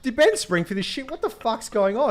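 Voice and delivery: high, incredulous retold questions; mock bewildered. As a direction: play up the confusion for laughs. Retold confusion about upgrade.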